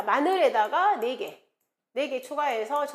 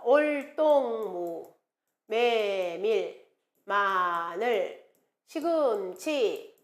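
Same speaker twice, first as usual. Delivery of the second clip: very slow, stretched-out words; slow item-by-item recitation; slow memorization drill; 3 semitones lower, much slower than usual. Shown as a demonstration. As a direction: draw out each item for students to memorize. Teacher spells out a memory list.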